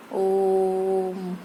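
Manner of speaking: "ओम" held very long on one level note; खींचा हुआ प्लुत स्वर. Shown as a demonstration. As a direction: stretch the vowel of "ओम" to triple length. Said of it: "तिगुना समय लगता है". ("ओम" को तिगुने समय तक खींचकर)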